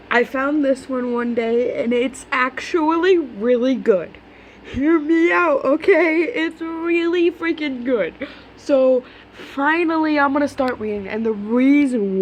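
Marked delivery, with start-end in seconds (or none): 4.6-6.2 high drawn-out plea; pleading. Real, not acted. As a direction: beg them to listen, slowly. Pleading with viewers.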